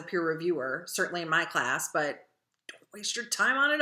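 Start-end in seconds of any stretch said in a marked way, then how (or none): none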